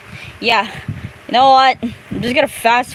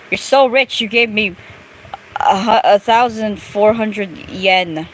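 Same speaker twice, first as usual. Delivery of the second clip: ordinary talk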